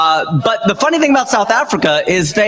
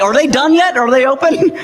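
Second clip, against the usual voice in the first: high, puzzled shopper; 9 semitones higher than usual. Retold or imitated. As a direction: ask it like a confused customer. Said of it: "you're like".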